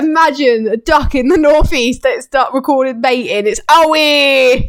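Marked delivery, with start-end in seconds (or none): 3.7-4.7 loud, higher mock yell; hammed-up frustrated cry of a mad gamer; imitated gamer anger. Host imagining a duck copying the gamer friend, mock angry yell. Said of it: "when you get mad".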